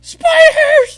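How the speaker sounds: high, loud yell of panic; panicked yell. Retold or imitated. Buddy freaking out as spiders cover the wall, loud high yell. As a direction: scream the word like you just saw them. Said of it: "he's freaking out".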